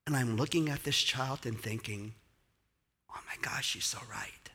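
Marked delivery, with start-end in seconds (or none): 3.1-4.5 hushed whisper of wonder; whispered inner thought, moved; awed whisper. Retold or imitated. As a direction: breathe it as a private realization.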